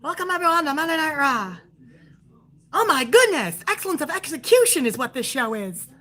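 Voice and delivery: high, thin mock commentator voice. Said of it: "It was, it was Verk Kirk".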